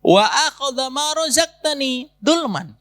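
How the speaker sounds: slow, chanted Arabic supplication; prayer recitation. Acted. Preacher recites the wronged fisherman's prayer, slow chanted supplication. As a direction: intone the prayer slowly and earnestly.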